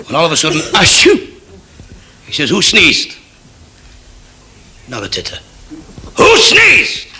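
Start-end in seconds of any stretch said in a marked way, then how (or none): 0.7-1.3 sudden high, spelt-out sneeze; mock sneeze. Soldier sneezes during inspection, sudden mock sneeze. 6.2-7.1 very high, louder shriek of a question; furious shout. Acted. Kosygin demands an answer from troops, furious high shout.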